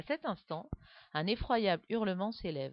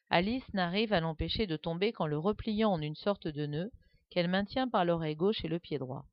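ordinary delivery

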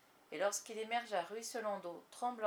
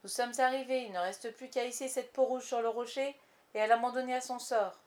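loud pirate calling out orders; shouted order; 3 semitones higher, 8 dB louder than usual. A narrator's reading. Pirate announcing arrival at the rock, shouted order. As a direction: bark it out to the crew. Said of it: "cria une voix irlandaise".

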